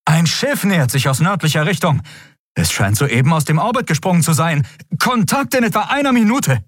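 high-pitched urgent report, climbing at the end; alarmed. A narrator's reading. Officer warns captain of an approaching ship, alarmed. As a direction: raise the pitch sharply in alarm. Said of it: "Die Augen des Menschen waren schreckgeweitet".

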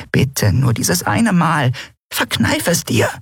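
slow, high, begging plea; high pleading. Acted. Nelen begs her friend not to jinx it, high and pleading. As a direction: beg her to hold back just once.

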